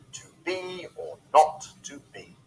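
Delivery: "to be or not to be" with BE, NOT and BE stressed; weak-strong beat through "to be or not to be"; iambic stress. Shown as a demonstration. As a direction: lean on every second word of "to be or not to be". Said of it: "first syllable is unstressed and the second is stressed".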